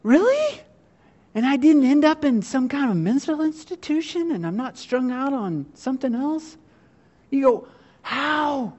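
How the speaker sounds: very high, astonished Really; marvelling at coming through intact, very high; astonished disbelief. Retold or imitated. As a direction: gasp at having survived. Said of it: "You're like".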